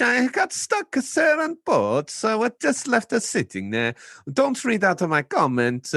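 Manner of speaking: high, hammed-up Italian accent with sir after every line; reading fan mail in a comic Italian voice. Host reads a fan's comment in a hammed-up Italian accent. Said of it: "he's trying to do an Italian voice as well".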